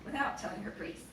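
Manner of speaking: usual speaking voice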